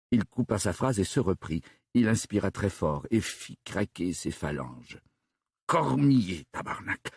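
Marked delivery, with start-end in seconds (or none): 5.7-7.1 restrained exasperation. A narrator's reading. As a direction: call the name out, then swear under your breath. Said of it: "recommença-t-il sur un ton plus calme".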